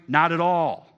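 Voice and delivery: loud, punched NOT at all; firm exclamation; emphatic denial. Real, not acted. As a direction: slam the door on it. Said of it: "Exclamation point".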